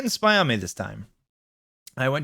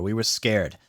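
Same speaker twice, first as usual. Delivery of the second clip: ordinary delivery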